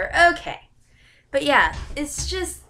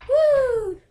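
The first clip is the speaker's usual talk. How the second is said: high, loud "woo" cheer; celebratory woo; 6 dB louder than usual. Real, not acted. Vlogger cheering a year on the channel, high loud woo. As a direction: let out a high, held woo.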